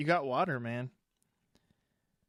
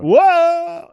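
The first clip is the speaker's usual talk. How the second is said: sudden high loud yelp; startled shout; 13 dB louder than usual. Real, not acted. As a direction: jump at something unexpected.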